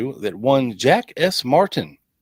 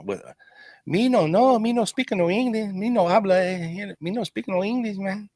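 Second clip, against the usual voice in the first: much higher, hammed-up Spanish-accented broken English; mock Spanish accent; 9 semitones higher than usual. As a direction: play a flustered guy faking he speaks no English, Spanish accent. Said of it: "spoke with a Spanish accent".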